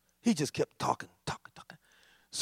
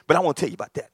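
usual speaking voice

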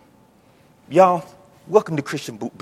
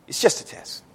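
whispered aside; 10 dB quieter than usual. Real, not acted. Preacher quiet aside.

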